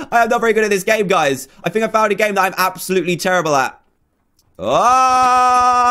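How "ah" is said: high, sustained "aah" yell; long scream. Real, not acted. Gamer in trouble in a match, long high scream. Failing in the game.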